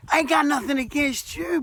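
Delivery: ordinary talk, nothing marked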